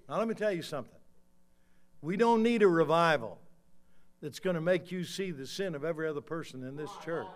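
loud, high-pitched pulpit proclamation; forceful preaching. Acted. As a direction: drive the warning home, stretching the final words.

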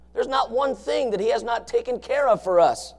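ordinary talk

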